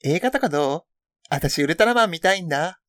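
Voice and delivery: casual schoolgirl read-out; teen girl chatter voice; gal voice read. Acted. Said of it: "ちょっとやらせていただいてましたけど".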